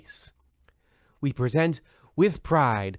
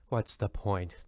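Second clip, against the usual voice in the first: weary aside; 5 semitones lower, 10 dB quieter than usual. Acted.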